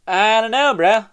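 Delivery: loud exasperated. Real, not acted. Exasperated loud aside to viewers.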